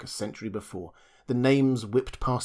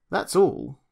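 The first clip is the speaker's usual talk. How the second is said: higher and louder, firm end; insistent; 8 semitones higher, 4 dB louder than usual. Acted. Julius insisting to the doctor, firm. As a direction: state it with force.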